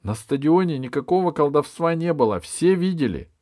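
high, loud, urgent protest; impassioned high-voiced testimony; indignant girl. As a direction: argue as a girl outraged by an unfair trial. Alice pleads for the boy.

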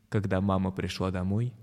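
ordinary delivery

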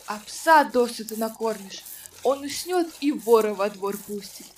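usual speaking voice

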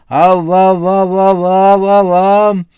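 high, loud, slow quavering babble; shaking moan. A narrator's reading. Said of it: "затрясся и стонал".

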